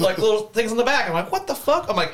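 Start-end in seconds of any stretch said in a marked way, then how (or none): 1.3-1.9 high, startled exclamation; shocked what the fuck. Retold or imitated. Telling the podcast crew about finding the man, high shocked outburst. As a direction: recoil in disbelief at the sight.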